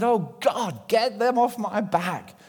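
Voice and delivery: urgent retold prayer for help; crying out to God. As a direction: plead upward for rescue, slowing on the middle phrase. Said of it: "he cried out to God".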